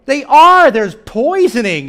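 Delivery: ordinary talk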